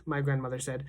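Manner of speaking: plain reading voice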